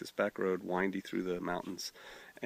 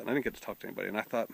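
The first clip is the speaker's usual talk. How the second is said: ordinary talk, nothing marked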